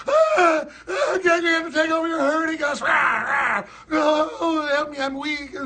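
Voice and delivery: high whiny mocking cries for help; mock whining protester. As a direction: ham up a weak victim. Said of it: "with their Red Guard stuff and then say".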